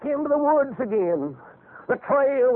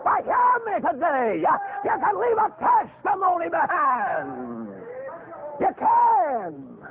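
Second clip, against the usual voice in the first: raised exhorting call; preaching at full force; 7 semitones higher, slower than usual. Acted. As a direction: lift the voice and plead.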